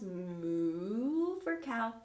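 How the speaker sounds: slow soft moo like a cow. Shown as a demonstration. Teacher showing kids the cow sound, slow soft moo. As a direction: draw out a gentle moo. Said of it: "you can make animal sounds".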